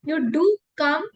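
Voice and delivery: You do come, with do pressed hard; emphatic do; stress on do. Shown as a demonstration. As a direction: lean hard on do. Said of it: "जब आप किसी बात को जोर दे के कहते हैं".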